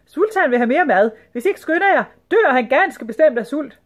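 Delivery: high, loud urgent calling; shouting lady. A narrator's reading. Lady hurrying the serving girls along, shouting. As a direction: shout orders in a high lady's voice. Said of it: "råbte en dame".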